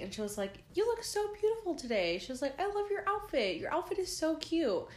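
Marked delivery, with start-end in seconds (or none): 0.7-2.2 high-pitched gushing compliment; syrupy, high-voiced flattery; sweet gushing. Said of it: "she was just so sweet". 2.6-4.9 high-pitched gushing compliment; syrupy, high-voiced flattery; sweet gushing. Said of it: "she was just so sweet".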